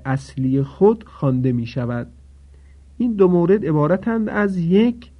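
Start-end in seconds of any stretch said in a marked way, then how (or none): none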